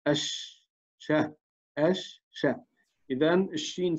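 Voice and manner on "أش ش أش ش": a whispered "ش" sound held on its own; isolated sh sound. Shown as a demonstration. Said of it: "الشين مشددة".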